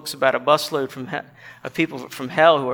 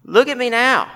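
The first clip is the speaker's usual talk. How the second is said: louder, higher protest; indignant ghost; 7 semitones higher, 5 dB louder than usual. Acted. Moral man's ghost to a bright man, indignant. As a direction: voice an affronted ghost demanding notice.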